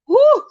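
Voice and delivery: a sudden high loud whoop; woo. Real, not acted. To her viewers, whooping after spooking herself. Shaking off a scare.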